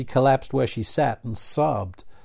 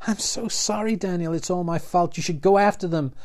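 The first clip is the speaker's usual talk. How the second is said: tearful apology; 8 semitones higher than usual. A narrator's reading. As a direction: apologize as if it's all your fault.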